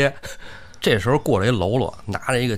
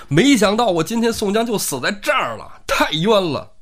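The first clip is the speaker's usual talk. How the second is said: loud, high wail of injustice; despairing cry; 10 semitones higher, 4 dB louder than usual. Acted. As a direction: cry out as if doomed. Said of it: "宋江在那儿就大喊一声".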